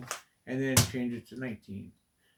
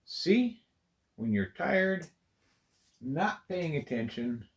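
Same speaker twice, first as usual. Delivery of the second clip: mock taunting viewers; 5 semitones higher than usual. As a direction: needle me like gloating viewers. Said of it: "laughing at me again, going".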